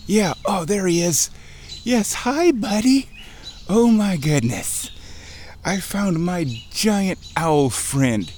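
high, thrilled greeting, slowing at the end; real delight, pitched high and drawn out; delighted owl greeting. Real, not acted. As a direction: gush over finding your owl friend.

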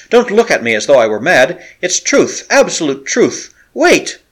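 high, loud and urgent, pleading to be believed; desperate appeal. A narrator's reading. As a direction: beg your friend not to think you mad. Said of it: "he cried".

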